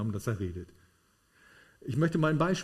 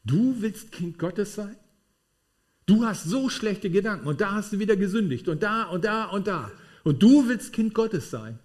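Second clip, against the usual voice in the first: mocking accuser; 5 semitones higher, 4 dB louder than usual. Devil accusing the believer, higher and loud, mocking.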